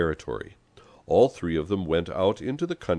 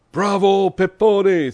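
high, very loud cheer; booming, high-pitched bravo; thundering shout; 10 dB louder, slower than usual. A narrator's reading. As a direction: bellow your approval from a window. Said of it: "thundered a voice from the first floor window".

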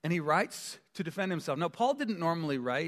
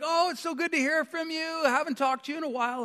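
high, gushing phone-chat voice; hammed-up friendly chatter, pitched way up; wife chatting on phone; 11 semitones higher than usual. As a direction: imitate a wife delighted by her caller. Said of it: "then she's like".

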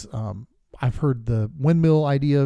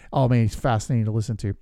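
ordinary talk

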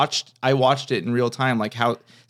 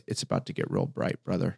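very low, hushed cool-guy line; Johnny Depp impression; 7 semitones lower, 10 dB quieter than usual. Waiter imitating the star's hushed deep line. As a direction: drop way down low and soft for a cool exit line. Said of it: "He goes".

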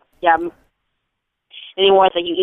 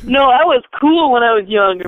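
a bit higher, lightly voiced boastful child; mother joins in mocking the kid; mock kid bragging; 4 semitones higher, 3 dB louder than usual. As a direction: brag like a kid who swears he was cool. Joining the mock kid denial.

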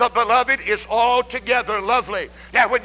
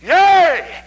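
loud exultant cry; 5 semitones higher, 6 dB louder than usual. Acted. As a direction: burst forth with emotion on YEA. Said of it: "begins to cry out".

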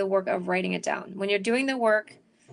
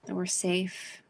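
calm, unhurried breathing guidance; guided meditation; 3 semitones lower, 5 dB quieter than usual. Acted. Leads a meditation.

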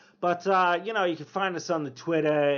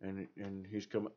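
usual speaking voice

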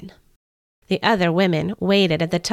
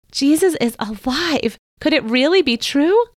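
amazed disbelief; 6 semitones higher than usual. Acted. Mary stunned by the news.

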